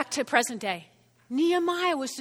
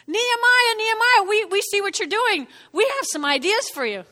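calling out; 7 semitones higher, 5 dB louder than usual. Acted. Opponents coaxing Nehemiah down off the wall, calling out. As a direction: holler up to lure someone down.

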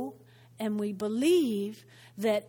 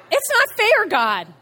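high, loud, aggrieved outcry; loud protest; 9 semitones higher, 11 dB louder than usual. Acted. Believer protests unfairness to God in a high outcry. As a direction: burst out complaining, pitch jumping up.

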